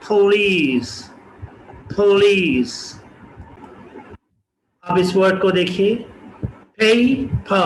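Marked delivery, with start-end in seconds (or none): 0.1-2.7 पुलिस with a puff of air on p; aspirated p. Shown as a demonstration. Teacher models पुलिस with aspirated ph. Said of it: "प को हम फ बोलेंगे". 6.8-7.5 पे फ with breathy p sounds; aspirated syllables. Shown as a demonstration. Teacher splits पेपर into aspirated syllables. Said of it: "प को हम फ बोलेंगे".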